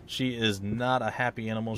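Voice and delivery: normal talking voice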